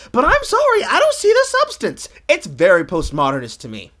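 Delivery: ordinary talk, nothing marked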